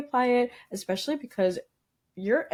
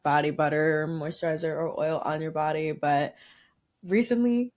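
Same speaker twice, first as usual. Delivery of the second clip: ordinary talk, nothing marked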